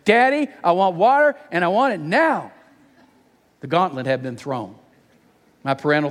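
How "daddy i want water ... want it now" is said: put-on loud, high child's demand; defiant little screech. Daughter demanding water from her bedroom, defiant high screech. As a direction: screech like a bossy little girl. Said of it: "a defiant little voice screeched".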